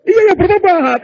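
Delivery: loud, high, hammed-up comeback; exasperated retort. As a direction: shout back as the cornered husband. Husband's exasperated reply in quarrel. (balasan kesal dengan suara keras)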